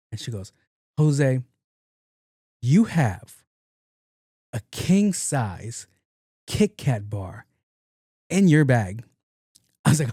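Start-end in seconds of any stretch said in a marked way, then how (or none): 1.0-9.0 measured accusation with long pauses; slow, pointed confrontation. Acted. Doctor confronting patient over hidden candy, slow and pointed. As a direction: spell out the charge piece by piece.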